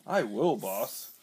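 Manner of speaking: slow, loud thug; henchman voice. Acted. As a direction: answer like a dim henchman. Henchman answers the villain.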